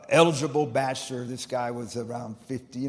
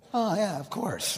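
higher-pitched bluff breaking into a laugh; feigned recognition; 4 semitones higher, 4 dB louder than usual. Retold or imitated. Preacher bluffing to his friend about Shakespeare, mock-knowing with laugh. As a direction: pretend you knew it, then laugh.

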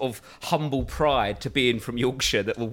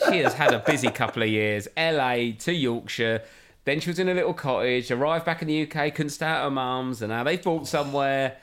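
ordinary delivery

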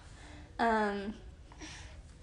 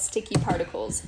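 ordinary talk

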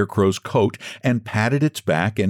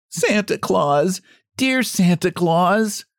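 high, slow, pleading call with a light sob; crying out with a sob; 10 semitones higher, slower than usual. A narrator's reading. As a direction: call out to Santa through a mild sob. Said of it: "cried Jimmy Scarecrow with a great sob".

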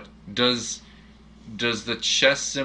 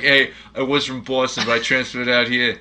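mock Boston accent, louder; 3 semitones higher, 6 dB louder than usual. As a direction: put on a playful Boston accent. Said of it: "how is he doing a Boston".

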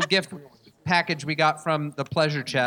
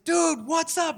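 higher, easygoing dude greeting; laid-back dude voice; 11 semitones higher, much slower than usual. Retold or imitated. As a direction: greet like a chill bro. Retelling the delivery guy's greeting.

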